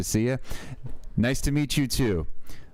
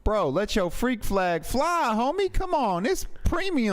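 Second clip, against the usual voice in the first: high hype bro voice; 10 semitones higher than usual. Pretend.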